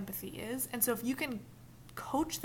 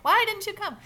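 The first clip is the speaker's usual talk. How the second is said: accusing; 12 semitones higher, 11 dB louder than usual. Retold or imitated. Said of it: "I'd probably even".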